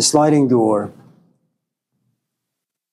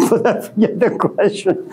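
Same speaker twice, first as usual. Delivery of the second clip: high burst of laughter; laughing; 8 semitones higher than usual. Real, not acted. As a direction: laugh through the words. Laughs at forgetting question.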